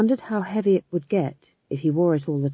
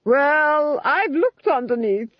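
higher, mocking villainess voice; scornful Duchess; 9 semitones higher, 4 dB louder, slower than usual. Duchess mocking Granny's attempt to shame her, high scornful sneer. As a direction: sneer down at the old witch.

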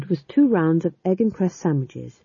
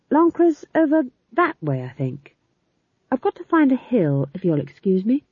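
put-on prim young witch; 7 semitones higher than usual. Magrat high voiced.

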